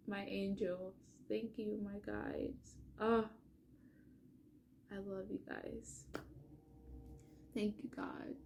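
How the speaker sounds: very soft, near-whispered thanks; moved, barely voiced thank-you; hushed gratitude. Real, not acted. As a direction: murmur a private prayer of thanks. Private thanks after moving reading.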